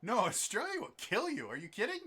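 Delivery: loud, high-pitched incredulous rant; exasperated high yell; mock disbelief. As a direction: protest as if they are out of their mind. Mock rant about deadly Australia.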